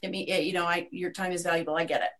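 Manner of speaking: usual speaking voice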